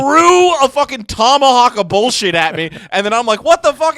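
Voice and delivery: loud, high-pitched retelling of being wronged; worked-up outrage. Retold or imitated. Podcaster retelling how his co-host insulted him, loud outrage. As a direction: rant it, voice climbing into a shout.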